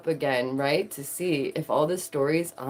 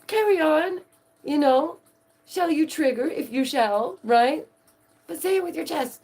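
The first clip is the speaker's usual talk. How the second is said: mock encouragement; 10 semitones higher than usual. Mocking taunt continues.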